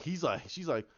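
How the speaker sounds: ordinary talk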